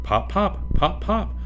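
high popping sounds; cork popping. Acted. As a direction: pop like a cork. Imitating a cork.

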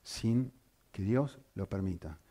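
usual speaking voice

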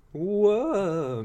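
high, drawn-out mock "whoa"; horrified cry. Retelling Jeb horrified by the Raccoon King, high cry. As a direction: yelp in hammed-up horror. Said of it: "he's just horrified".

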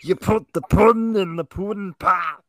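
high, loud, goofy Cosby voice; terrible Cosby impression. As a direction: do a bad Bill Cosby. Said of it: "that was my terrible impression".

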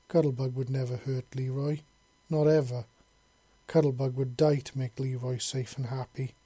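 ordinary delivery, nothing marked

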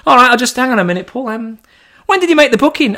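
the receptionist scrambling for the booking; flustered staffer. Retold or imitated. Receptionist to Paul, high and flustered.

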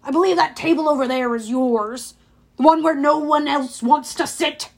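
pointed, belittling put-down; snide dismissal. A narrator's reading. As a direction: send him off with relish. Dionysus scornfully dismisses the boy.

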